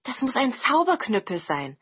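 high-pitched, delighted amazement; excited wonder. Acted. Siblings find a heap of new clothes, excited wonder. Siblings discover the magic club.

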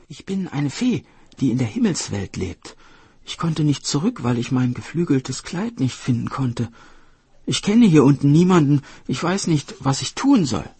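high-pitched, plaintive fairy; helpless young fairy. Acted. Stranded fairy telling woodcutter she knows nobody here, high and helpless.

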